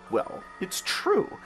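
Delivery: usual speaking voice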